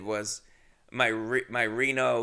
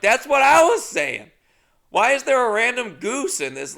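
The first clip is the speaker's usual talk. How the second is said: much higher and louder, worked-up disbelief; excited bafflement; 11 semitones higher, 9 dB louder than usual. Retold or imitated. Teller and stranger puzzling over the goose, excited and loud. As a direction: exclaim it in wild disbelief.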